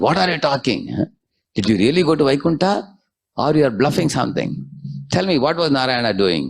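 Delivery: high, indignant accusing questions; angry demand. Acted. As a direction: challenge him as if he's lying. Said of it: "red hot in anger".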